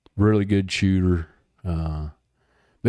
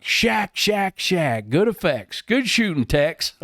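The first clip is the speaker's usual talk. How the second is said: higher, excited retold radio call; mock radio cheer; 10 semitones higher, slower than usual. Bob cheering the pilot's hit on the radio, excited high call. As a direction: hype up the shooter over the radio.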